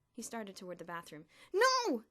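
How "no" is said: high panicked shout. A narrator's reading. Elmer shouting to stop Bruno from bathing, very high and loud. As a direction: yell to stop him. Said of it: "Elmer shouted".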